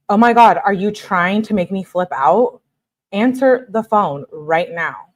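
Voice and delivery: normal talking voice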